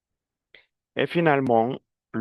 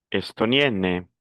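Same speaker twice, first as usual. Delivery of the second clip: normal talking voice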